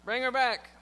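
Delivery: a judge ordering the bailiff, loud and firm; angry command. Retold or imitated. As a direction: bark it out loud. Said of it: "he says".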